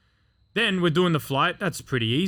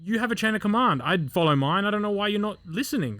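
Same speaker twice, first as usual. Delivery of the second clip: high-pitched retold argument; indignant retort; 7 semitones higher than usual. Trainee to officer over missed briefing, indignant. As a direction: push back at a superior, indignant.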